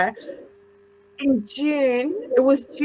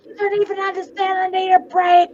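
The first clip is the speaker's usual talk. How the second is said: much higher, whiny put-upon voice; worn-out wife melting down at her husband, higher and whiny; retold meltdown; 7 semitones higher than usual. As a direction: whine it up high like a fed-up wife. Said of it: "I lost it".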